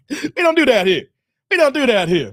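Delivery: loud, laughing refusal; mock scolding. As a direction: lay down the law loudly, half laughing. Jokingly rejects the parodied talk.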